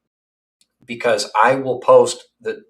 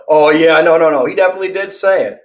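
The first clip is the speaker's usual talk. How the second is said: louder and higher, fast; loud insistence; 6 semitones higher, 6 dB louder, faster than usual. Real, not acted. Insisting Boss Baby really said it, loud and fast. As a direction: burst out insisting.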